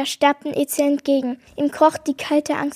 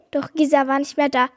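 ordinary delivery, nothing marked

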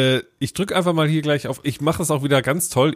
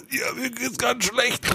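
soft, slow, higher whiny sick voice; faking sick; 9 semitones higher, 6 dB quieter, slower than usual. Retold or imitated. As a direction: pretend you're too ill for school. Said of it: "da hat man eben so". (kränklich gespielt)

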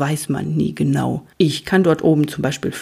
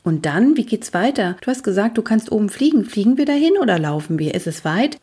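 higher, eager string of questions; curious young Luzifer; 5 semitones higher, faster than usual. Acted. Luzifer quizzes his uncle about the journey, eager and higher. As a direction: pepper the listener with eager questions.

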